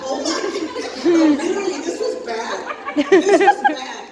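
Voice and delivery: loud laughing disbelief; a laughing oh my goodness; laughing exclaim. Real, not acted.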